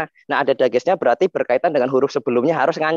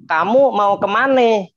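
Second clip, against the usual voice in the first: Betawi accent, "kemana" ending in E as "kemane", slow; 4 semitones higher, slower than usual. Shown as a demonstration. Teacher demonstrating the Betawi accent on a sample question, "kemana" said "kemane". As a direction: say it like a Betawi local, final vowel E. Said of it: "Orang-orang Betawi gitu".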